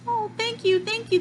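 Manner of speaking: squeaky thankful robot; little robot voice. Retold or imitated. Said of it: "the robot says".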